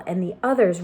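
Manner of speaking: plain reading voice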